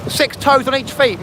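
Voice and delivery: high, slow teasing sing-song; mocking taunt. Teasing the Guernsey folk across the water, high slow mock voice. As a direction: taunt the islanders in a silly high voice.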